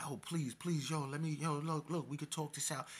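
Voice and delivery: high, hurried imitation of begging; mock pleading. Retold victim begging storyteller to talk it out, pleading. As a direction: imitate a cornered man pleading. Cornered man begs at gunpoint.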